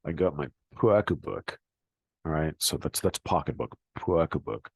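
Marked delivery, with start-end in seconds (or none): 0.0-1.6 "pocketbook" as "pwockabook" in a Boston accent. Shown as a demonstration. Teacher using Boston "pocketbook" in a sentence. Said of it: "I'm pushing the lower jaw and lip out". 4.0-4.7 "pocketbook" as "rwockabook", lower lip pushed out; Boston pocketbook. Shown as a demonstration. Teacher modelling jaw-out Boston "pocketbook". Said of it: "pushing the lower jaw and lip out".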